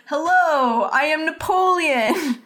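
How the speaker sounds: put-on high, drawn-out grand announcement, no French accent; Brain posing as Napoleon. Host mocks Brain introducing himself as Napoleon, grandly unconvincing. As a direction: proclaim it slowly, making no attempt at French. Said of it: "doesn't even try to put on a French accent".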